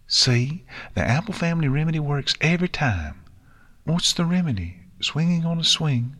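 high, bright-eyed little fillies chatting; filly voices, cheerful. Acted. Fillies after the swing ride, cheerful.

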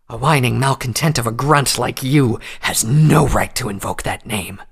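loud, seething threat; furious threat. A narrator's reading. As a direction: threaten him through clenched teeth. Nightcanter grips prisoner's jaw.

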